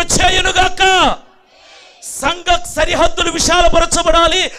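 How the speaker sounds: loud prophetic blessing, building to a shout; mounting, shouted pulpit decree; full-force declaration. Real, not acted. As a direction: proclaim the blessing over the congregation.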